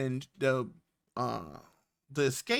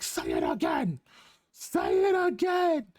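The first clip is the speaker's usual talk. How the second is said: mock furious challenge. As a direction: dare him to repeat it, rising into a yell. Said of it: "and he's like".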